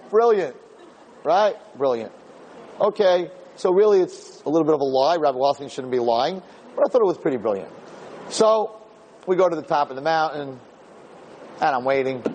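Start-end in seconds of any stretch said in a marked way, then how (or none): none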